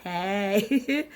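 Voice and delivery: raspy, worn-out greeting; hoarse from being sick. Real, not acted. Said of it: "my voice sounds hoarse".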